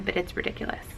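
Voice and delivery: unvoiced whisper, fighting tears; whispered frustration. Real, not acted. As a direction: hush it, trying not to break down. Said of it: "I'm not gonna cry".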